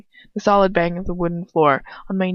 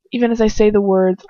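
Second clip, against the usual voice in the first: ordinary delivery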